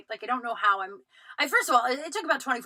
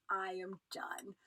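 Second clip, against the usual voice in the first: quiet; 12 dB quieter, slower than usual. Retold or imitated. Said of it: "the most quiet, solace moment".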